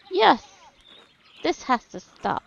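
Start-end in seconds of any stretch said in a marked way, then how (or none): 0.1-0.5 loud eager yes; shout. Acted. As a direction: shout your agreement. Crowd cheering the rebellion.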